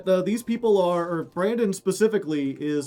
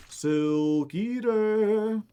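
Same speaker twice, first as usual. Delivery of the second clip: "Silk Eater" stretched very long, higher; drawn out; 4 semitones higher, much slower than usual. Pretend. Player repeats the Silk Eater name, drawn out. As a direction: draw out both words of "Silk Eater" playfully.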